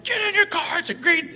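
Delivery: very high, strained mock scream; road-rage screech without full volume; retold screaming. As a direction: screech it at the other driver. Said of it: "You know, we're screaming".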